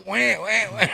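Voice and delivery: high put-on wailing wah wah; mock baby crying. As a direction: fake a crybaby's wail for laughs.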